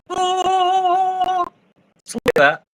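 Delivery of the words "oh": high awed gasp of wonder; a long high amazed oh. Retold or imitated. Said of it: "when you open a door and it goes".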